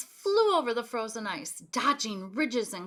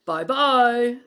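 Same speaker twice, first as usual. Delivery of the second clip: big gruff bear voice; 2 semitones higher, 12 dB louder than usual. A narrator's reading. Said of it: "a big gruff voice".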